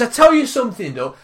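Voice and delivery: loud, high, argumentative retort; heated comeback, re-enacted; retold shout back. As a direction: call it out mid-row. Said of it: "so I shouts back".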